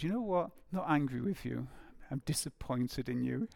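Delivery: low, soft, understated disappointed parent; mild disappointment. Acted. A parent to an erring child, gently voiced disappointment. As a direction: let quiet disappointment sink in, acted lightly. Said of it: "perhaps Jesus looked disappointed".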